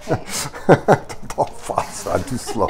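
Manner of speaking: soft, slow laughing reply; quiet amused giggle; laughing softly. Real, not acted. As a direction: laugh it off gently. Laughing reply to wife's remark.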